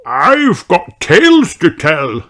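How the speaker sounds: put-on slow, high old rabbit voice; earnest answer; Grampy Rabbit. A narrator's reading. Grampy Rabbit answering Peppa, slow old voice.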